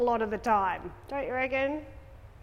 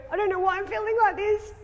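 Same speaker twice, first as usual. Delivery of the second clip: high, louder, panicky quote; anxious self speaking in a high, louder voice; freaking out; 9 semitones higher, 5 dB louder, faster than usual. Retold or imitated. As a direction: play someone who is freaking out about a feeling. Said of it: "If I'm, if I'm freaking out, I'm like".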